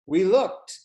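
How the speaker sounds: high, loud surprised look; sudden surprise at the cat; surprised gasp-word. A narrator's reading. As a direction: react with a start.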